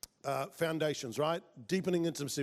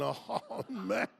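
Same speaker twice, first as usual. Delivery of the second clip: half-laughing mock panic, pitched up; freaking out inside; 6 semitones higher than usual. Speaker's inner panic at a risky request, freaking out. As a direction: groan it high in comic dismay. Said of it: "I'm like, I'm freaking out".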